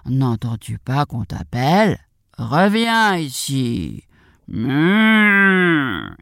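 low, slow cow voice with a moo; cow character. Acted. Cow calls the runaway back, slow drawn-out cow voice. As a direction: draw the words out like a lowing cow.

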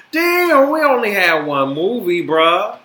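indignant complaint. Real, not acted. Continued rant.

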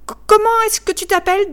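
put-on boy asking his new father's name; eager child. A narrator's reading. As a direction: ask like a small eager boy. Child asks the workman's name.

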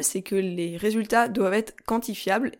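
ordinary delivery

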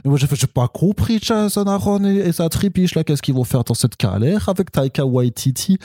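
fan's rant in a fake Alsatian accent; mock Alsatian accent, sliding from Quebec; botched Alsatian accent. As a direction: grumble about the film in hammy Alsatian French. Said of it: "ça part en accent alsacien".

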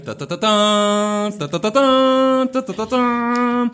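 sung studio fanfare, ta ta ta; Universal fanfare. Retold or imitated. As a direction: belt it like the film logo music. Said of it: "le bruit de Universal".

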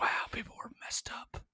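hushed, whispered aside; barely voiced deadpan disbelief; straight-faced. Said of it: "say it with a straight face and just be like".

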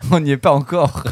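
through a chuckle; laughing. Real, not acted. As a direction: say it while still laughing. Said between laughs, then repeated.

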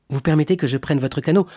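ordinary delivery, nothing marked

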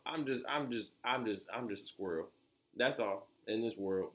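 ordinary talk, nothing marked

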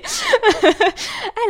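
high, loud real laugh. Real, not acted. Guest amused at having to re-explain, high laugh.

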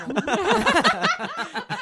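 high squealing laugh; burst of high laughter. Real, not acted. Laughing at the vocoder clip.

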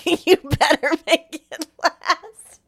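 can't get the quote out for laughing; slow, loud, giggling quote. Retold or imitated. Quoting the line while cracking up.

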